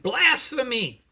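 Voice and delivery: outraged shout of blasphemy. Acted. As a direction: hurl the accusation at the whole council. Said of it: "and he shouted".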